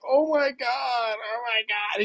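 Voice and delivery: slow, high whiny mock sobbing; mock crying. The passenger peeled from his seat, mimicked in slow high mock sobs. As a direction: drag out a whimpering fake cry. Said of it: "he's like".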